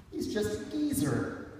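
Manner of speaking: soft, slow relieved breath; breathed in relief. Acted. Micah relieved, seeing the creature is old. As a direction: let the fear go out of it. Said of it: "Micah breathed in relief".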